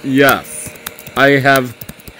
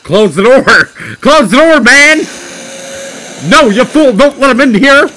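panicked yelling; 8 semitones higher, faster than usual. Real, not acted. Scared player yelling at game characters to close the door, high panicked yell. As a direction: shriek at them to shut the door.

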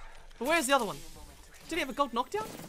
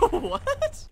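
very high, squeaky laughing disbelief; incredulous laugh; 8 dB louder than usual. Real, not acted. Player learns there are four enemies, incredulous laughing. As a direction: squeal "what" through a laugh. Surprised by scan count.

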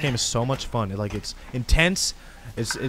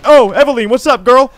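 high, very loud call-out on spotting her; loud greeting; 9 semitones higher, 13 dB louder than usual. Real, not acted. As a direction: hail her suddenly, pitched up. Spots Evelyn, calls out to her.

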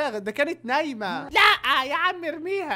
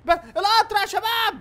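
excited call; 7 semitones higher than usual. Real, not acted. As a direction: call out that the train is here. Train arrives, calling viewers.

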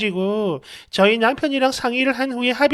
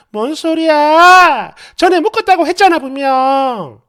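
loud, high outraged protest; wife yelling at her husband; indignant yelling; 4 semitones higher, 7 dB louder than usual. Acted. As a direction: drag out the angry words loudly. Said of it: "왜 이렇게 소리를 질러?".

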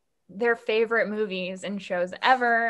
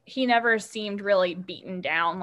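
ordinary delivery

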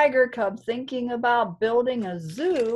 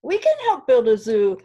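eager, quick, louder fly voice; buzzing fly; 3 semitones higher, 8 dB louder, faster than usual. Acted. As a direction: chirp it out fast like a keen little fly. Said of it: "buzzed the fly".

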